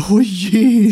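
high, loud flower voice; a spiteful flower chiming in; mocking flower. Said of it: "sagte eine Blume". (spöttische Blume mit hoher Stimme)

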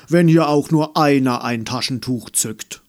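loud gruff warning. Acted. Clarence warning the sniffling room, loud and mock-threatening. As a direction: boom it out to stop anyone crying. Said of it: "warnte er".

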